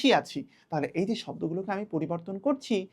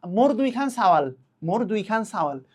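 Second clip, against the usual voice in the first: rural Bengali dialect, a little louder on মোর; regional Bengali dialect; 4 dB louder than usual. Shown as a demonstration. Teacher showing students a regional Bengali dialect. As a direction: say it the way a village speaker in a Bengali region would. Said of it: "এলাকা ভেদে এক এক জায়গাতে এক এক রকমভাবে বলা".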